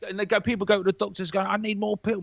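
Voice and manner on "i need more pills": mock pleading patient, higher; lightly mocked pill-hungry patient; whiny patient. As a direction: beg the doctor for pills in a mocking whine. People begging the doctor, mocked.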